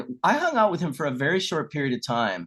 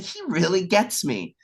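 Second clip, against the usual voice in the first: delighted; 7 semitones higher than usual. Retold or imitated. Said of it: "I was like".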